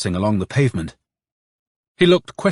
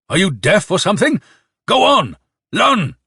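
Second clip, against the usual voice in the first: high, loud, exasperated order; furious yell; 8 semitones higher, 3 dB louder than usual. A narrator's reading. Colonel ordering Nikolka to run, yelling furiously. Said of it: "yelled furiously".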